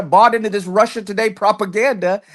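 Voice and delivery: normal talking voice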